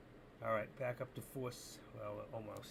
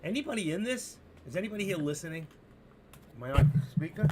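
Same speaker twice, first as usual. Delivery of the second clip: loud, high call to the room; 4 semitones higher, 11 dB louder, faster than usual. Real, not acted. Streamer asking silent chat if he's audible, calling out loud. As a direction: call out to see if anyone's there. Checking if chat hears him.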